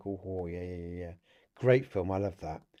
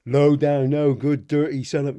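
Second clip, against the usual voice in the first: loud, higher mock tirade; imitating a furious dad yelling at his son; retold insult; 4 semitones higher, 10 dB louder than usual. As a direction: bark out the insults at Bender. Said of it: "he says".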